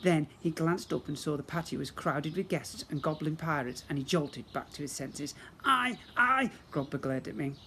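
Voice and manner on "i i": spluttering rage. Acted. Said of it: "sick with rage".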